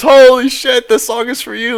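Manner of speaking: put-on high excited drunk yell; excited drunk fan.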